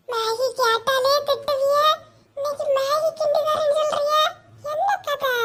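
high, softened, wary questioning; suspicious. Acted. Child questioning mother's sudden Maggi offer, suspicious. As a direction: draw it out, high and doubtful.

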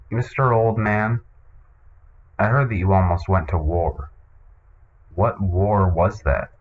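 ordinary talk

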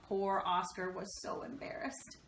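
ordinary delivery

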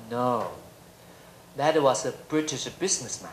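slow, soft, measured English put-down; smug Englishman. Retold or imitated. Lecturer quoting the Brit correcting him on Taiwan tea, slow and smug. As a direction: deliver the correction slowly, quietly superior.

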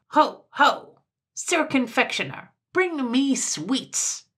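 high, imperious shout across a shop; loud, high summons; loud cry. A narrator's reading. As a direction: call out grandly, drawing out the order. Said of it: "cried in ever so loud a voice".

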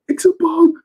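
gasp, then a very high alarmed squeal; startled Usopp. Retold or imitated. Usopp spotting the bug, gasping at a very high pitch. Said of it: "He said".

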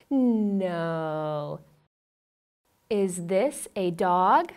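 put-on slow sing-song questions for a toddler; reading to a toddler. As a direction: ask it like a picture-book game. Quizzes toddler about pictures.

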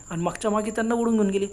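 ordinary delivery, nothing marked

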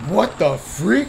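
slow, loud stunned question; drawn-out loud disbelief; shocked. Real, not acted. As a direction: let the shock stretch every word. Shocked discovery.

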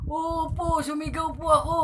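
put-on high old lady insisting; granny voice. Granny telling the officer she did shout. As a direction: answer eagerly like a granny. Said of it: "Sabi ni Lola".

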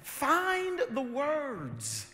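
high, drawn-out parental plea; acted patient exasperation, slow and high; God coaxing a baby. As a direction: stretch the words high, as if to a crying infant. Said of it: "He says".

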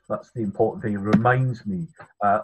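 normal talking voice

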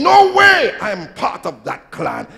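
loud, vehement opening, then easing; emphatic denial. Acted. Peter disowning the disciples, loud emphatic denial. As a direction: swear it with a burst of force. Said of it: "He was saying".

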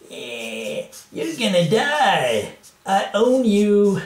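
high, loud, drawn-out American threat; hammed-up terrible American accent; American cat villain. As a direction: yell it slowly as a cartoon cat in bad American. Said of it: "I don't know why he's American".

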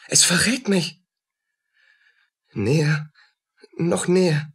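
breathless scared whisper; frightened whisper. Acted. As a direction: hiss it in fear. Allein am Tatort, Angst. (ängstliches Flüstern)

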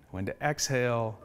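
soft, hushed guided-meditation delivery. Shown as a demonstration. Founder demoing a breathing tool to investors, meditation voice. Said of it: "put my meditation voice on".

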